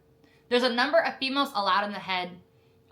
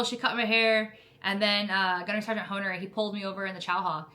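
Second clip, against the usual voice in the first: ordinary talk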